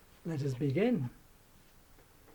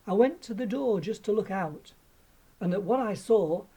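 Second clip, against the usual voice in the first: plain reading voice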